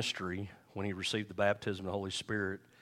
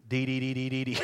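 playful babbling syllables, a touch higher; mock tongues babble; 3 semitones higher than usual. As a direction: imitate a beginner's stuttering tongues. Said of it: "you may come up here and you may go".

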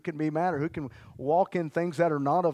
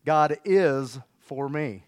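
drawn out and louder, weight on is; slow declaration; 5 dB louder, much slower than usual. Shown as a demonstration. Pastor modelling the line for the church, slow and loud. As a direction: pull each word long and firm. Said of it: "Say it like this".

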